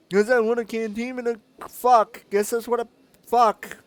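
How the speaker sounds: high, loud goofy voice; squeaky mock character voice; high silly voice.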